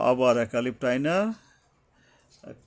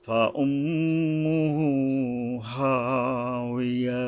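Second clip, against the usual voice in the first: slow, level tajweed chant; Quran recitation; much slower than usual. Shown as a demonstration. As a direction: recite slowly with held nasals and long vowels. Recites the verse through. (তাজবিদসহ ধীরে কুরআন তিলাওয়াত)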